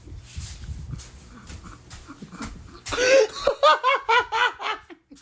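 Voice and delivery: long high cackle; high shrieking laugh. Real, not acted. Laughing hard at her own joke, high cackle. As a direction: let a big high laugh rip.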